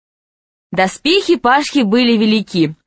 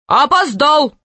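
higher-pitched, protesting judge; objecting; 6 semitones higher, slower than usual. A narrator's reading. Chief judge turning down a late challenger, objecting. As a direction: object sharply with raised pitch. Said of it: "воспротивился главный судья".

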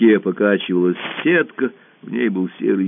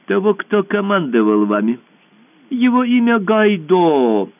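voiced emperor; 10 semitones higher than usual. Emperor interrogates Alice.

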